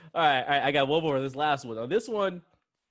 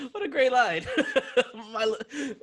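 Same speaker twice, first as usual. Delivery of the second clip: laughing; 11 semitones higher than usual. Real, not acted. Laughing at the line.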